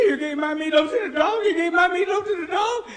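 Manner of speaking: loud, high-pitched mock wail; crying wife. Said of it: "Don't cry, baby".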